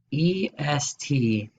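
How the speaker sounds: very slow, each letter spelled out; slow classroom dictation. Shown as a demonstration. Teacher spells out suffix slowly.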